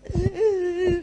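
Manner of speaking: high, whimpering fake sobs; mock sobbing. Woman still crying while cooking, high mock sobs. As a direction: imitate sobbing through a chore. Bahkan lagi masak aja masih.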